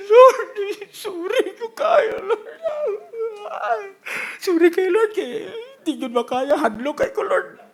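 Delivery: high, drawn-out mock crying; mock tearful pleading. Preacher mimics Peter tearfully apologizing to the Lord, mock sobbing. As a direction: wail it out like a weepy apology. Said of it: "Ang mura tang manghinilakay".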